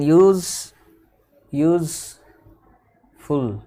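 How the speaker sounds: यूज drawn out, then यूजफुल as yooz, long pause, ful; two-syllable split. Shown as a demonstration. Teacher confirming only two syllables, यूजफुल split with a long gap. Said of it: "दो ही syllable है".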